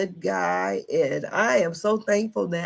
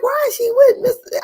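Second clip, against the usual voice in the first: much higher, louder mock disbelief; incredulous squeal; 7 dB louder than usual. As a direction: squeal it like a shocked kid at the TV. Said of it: "I was like".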